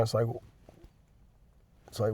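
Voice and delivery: ordinary delivery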